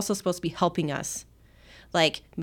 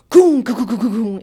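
goon goo sound effect; 3 semitones higher, 8 dB louder than usual. Retold or imitated. Chair punted down the hall.

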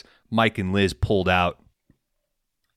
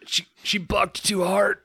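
high, strained mock lament; dying [name], mock; 10 semitones higher than usual. [name] voicing his own death, high mock wail.

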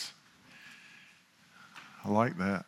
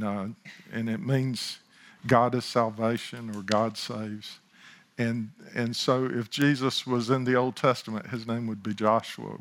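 normal talking voice

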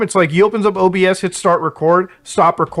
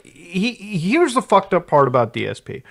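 ordinary talk

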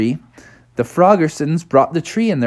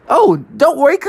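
much higher breezy beaver voice; Bucky the beaver; 13 semitones higher, 3 dB louder, faster than usual. Acted. Bucky reassuring the frogs, high. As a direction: brush it off cheerfully.

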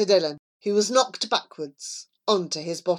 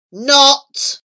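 loud, higher taunting burst; spring the cruel joke loudly; gleeful taunt; mocking retort; 6 semitones higher, 9 dB louder than usual. Snatching back a mock offer.

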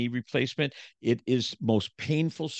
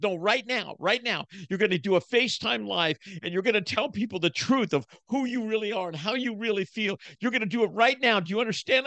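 high, firm insisting wife voice; high commanding mock wife; 10 semitones higher than usual. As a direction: lay down the law as his wife. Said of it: "She goes".

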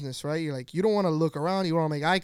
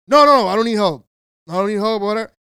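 very loud, higher-pitched imitated brush-off; brusque refusal; 4 semitones higher, 13 dB louder, faster than usual. Husband shutting out the employee's offer, loud brush-off. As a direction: cut the helper off with a loud no. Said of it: "he instantly shuts him out".

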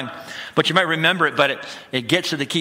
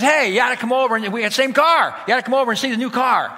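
higher, louder, bursting with eagerness; eager neighbor; 8 semitones higher, 5 dB louder, faster than usual. Retold or imitated. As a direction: call him over like a proud neighbor who can't wait to show off. Neighbor inviting to see car.

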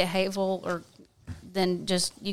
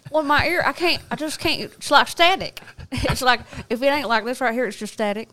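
put-on higher, louder, laying on the Southern accent; thick Southern accent; 5 semitones higher, 3 dB louder than usual. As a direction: go full Southern on it, half laughing. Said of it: "Y'all think I sound like Ruth".

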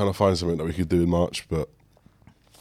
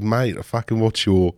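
slow mock Australian accent; imitating an Australian fan in Venice; 3 semitones higher, 3 dB louder, slower than usual. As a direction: drawl it out like an Aussie fan. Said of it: "He was Australian. He goes".

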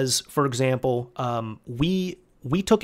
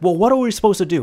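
fast, louder, higher mock exasperation; imitating an indignant mom, quick and raised; exasperated; 6 semitones higher, 6 dB louder, faster than usual. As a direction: rattle it off like an exasperated customer. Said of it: "She's like".

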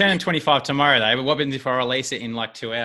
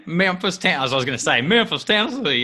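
higher, put-on Memphis movie accent; mock Tennessee accent; 5 semitones higher than usual. Host imitating Memphis locals from movies, mock Tennessee accent. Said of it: "Is that how they speak?".

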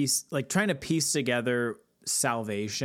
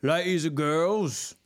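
put-on Cockney accent; 5 semitones higher, 3 dB louder, slower than usual. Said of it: "Cockney accent for the Australian".

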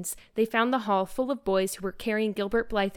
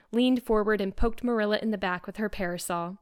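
ordinary delivery, nothing marked

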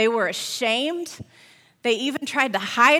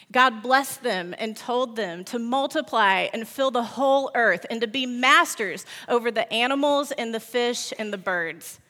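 plain reading voice

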